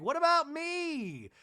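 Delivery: left-out whine. Retold or imitated. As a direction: plead not to be forgotten. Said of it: "Maylee's like".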